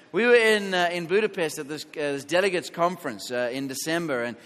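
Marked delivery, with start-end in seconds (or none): none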